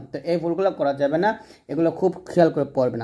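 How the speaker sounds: ordinary talk, nothing marked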